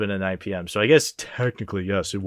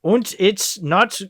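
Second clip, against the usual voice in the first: put-on high, slow, loud German accent; 10 semitones higher, 6 dB louder, slower than usual. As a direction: ham up a German film villain, German accent. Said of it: "I can't do a German accent".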